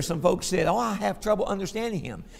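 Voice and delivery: ordinary delivery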